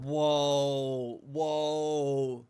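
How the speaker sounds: soft, drawn-out wonder; quiet amazement; hushed awe. Real, not acted. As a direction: breathe it out, awestruck. Awe at the sight.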